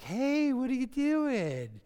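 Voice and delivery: high, slow, teasing mock surprise; playful wife. Wife turns around and teases her husband playfully. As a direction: tease him back like a flirty wife. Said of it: "she turns around and like".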